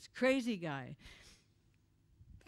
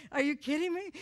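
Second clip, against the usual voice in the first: high, loud mock disbelief; acted-out disbelief, pitched up and louder; incredulous reaction; 7 semitones higher, 5 dB louder than usual. Woman retelling her shock at the stoning story, incredulous.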